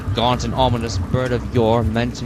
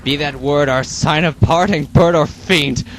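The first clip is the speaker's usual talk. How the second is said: loud, raised shriek; 3 semitones higher, 7 dB louder than usual. Acted. The speaker shrieks at the raven, starting up. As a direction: leap up and shriek at the bird. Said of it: "I shrieked".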